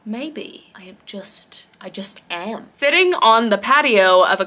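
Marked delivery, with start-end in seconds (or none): 0.1-2.7 slow deadpan whisper; soft, slow whispered confession. Real, not acted. Unvoiced whisper heard.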